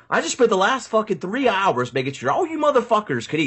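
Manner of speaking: high whiny rant of a brat; bratty tantrum. Retold or imitated. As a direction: throw a little temper tantrum at them. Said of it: "I pitched a little fit".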